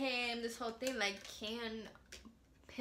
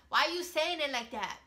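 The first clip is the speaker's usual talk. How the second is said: louder, higher challenge to a friend; indignant retort; 4 semitones higher, 7 dB louder than usual. Retold or imitated. Challenging a school friend over a bread and coffee remark, indignant. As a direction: replay it as a raised-voice confrontation. Replaying eighth-grade argument with friend.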